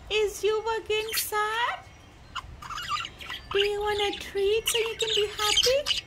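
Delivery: high, coaxing voice for a pet bird; pet talk. Real, not acted. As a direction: coax the bird with a treat, pitched high. High pet talk offering treat.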